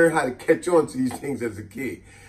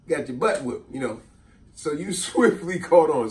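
usual speaking voice

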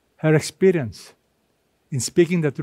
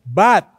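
"But" punched louder, set apart; stress on BUT; 5 dB louder than usual. Shown as a demonstration. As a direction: hit the word "But" hard, then pause. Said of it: "notice the word but".

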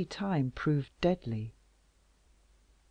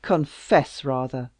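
loud, slow bitter cry; cried; 7 dB louder than usual. Acted. King accusing Louise, loud. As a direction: hurl it at her. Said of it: "cried Louis".

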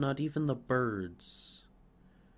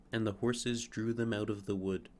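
plain reading voice

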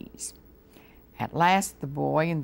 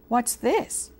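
a high little squeak of surprise; surprised; 8 semitones higher than usual. A narrator's reading. Mouse finding cheese, asks in surprise. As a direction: ask it suddenly, caught off guard. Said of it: "said the mouse in surprise".

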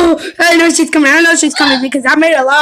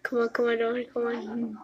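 tense whisper; 4 semitones lower, 18 dB quieter than usual. Real, not acted. Hiding, tense urging.

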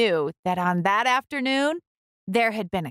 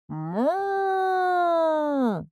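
higher, drawn-out moo; mooing cow; 9 semitones higher than usual. A narrator's reading. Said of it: "said cow".

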